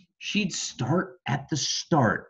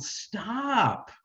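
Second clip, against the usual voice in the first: high snapped Stop; parents yelling stop; 9 semitones higher, 3 dB quieter than usual. Acted. Parents stop Ada's experiment, high sharp yell. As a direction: cut her off like exasperated parents. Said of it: "her parents yelled".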